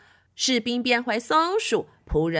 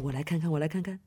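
plain reading voice

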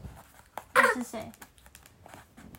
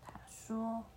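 whisper; 10 dB quieter than usual. Shown as a demonstration. Narrator reading to the child, whispering. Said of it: "很小声地说".

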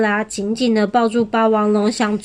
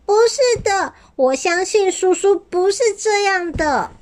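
little dinosaur voice; 10 semitones higher than usual. A narrator's reading. Weak little dinosaur pleads.

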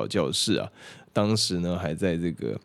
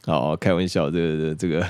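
normal talking voice

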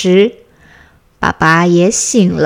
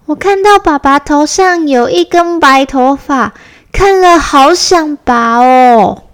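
higher, louder eager little cub; 高亢的小孩声音; little bear child voice; 7 semitones higher, 4 dB louder than usual. A narrator's reading. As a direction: play an excited small child. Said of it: "小熊说".

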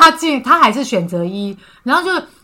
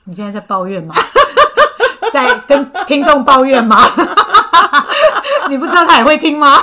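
laughing tease; 5 semitones higher, 3 dB louder than usual. Real, not acted. Host teasing guest, laughing.

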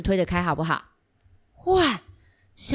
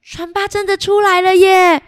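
excited; 11 semitones higher, 6 dB louder than usual. Real, not acted. Rescue succeeds.

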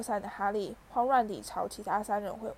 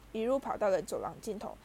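ordinary delivery

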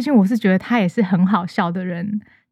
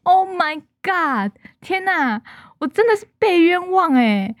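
high, drawn-out exasperated exclamation; dramatic disbelief; 8 semitones higher than usual. Real, not acted. Podcaster protesting being blamed for friend's affair, dramatic disbelief. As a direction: protest the injustice in a raised pitch.

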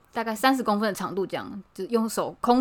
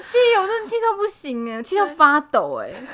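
indignant outburst; 10 semitones higher, 6 dB louder than usual. Real, not acted.

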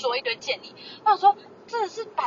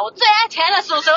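loud mock boast; 5 semitones higher, 7 dB louder than usual. Mock defiance to uncle.

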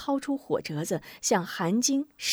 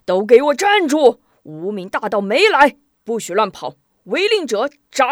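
loud, higher barked orders; commanding shout; 3 semitones higher, 9 dB louder than usual. Acted. A general sword raised halts his panicking army, furious shout. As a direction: roar at the fleeing troops to halt. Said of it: "挥刀制止乱军".

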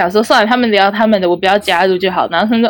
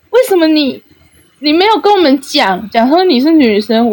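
alarmed; 6 semitones higher than usual. Retold or imitated. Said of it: "很惊恐的问我说".

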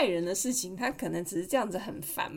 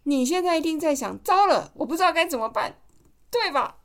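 higher, louder mocking call; gleeful taunt; 7 semitones higher, 5 dB louder than usual. Mother acting the child taunting the mind-reader. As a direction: crow over the beaten monster. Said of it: "你要继续说".

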